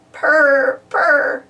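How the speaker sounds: drawn-out spoken purring; cat purr. A narrator's reading. Pilchard happy to find her friend, purring. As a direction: purr the word contentedly. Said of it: "said Pilchard".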